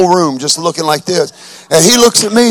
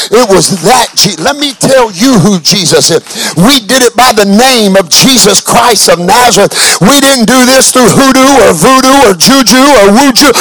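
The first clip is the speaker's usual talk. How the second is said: rising louder, higher preaching; building sermon; 6 semitones higher, 7 dB louder than usual. Acted. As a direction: climb back to full fire. Builds back into full preaching.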